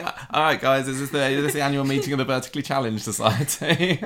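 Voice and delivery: high, loud comic entrance, laugh creeping in; David joking. Acted. Imagined wedding guest teasing the short cast, laughing. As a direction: call it out brightly, close to cracking up. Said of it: "David come into the wedding going".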